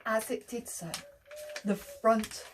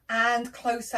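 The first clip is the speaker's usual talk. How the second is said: ordinary delivery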